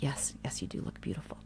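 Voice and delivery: very soft, low, hushed confirmation; tender reassurance. Retold or imitated. Mom answering her daughter's doubt, tenderly reassuring.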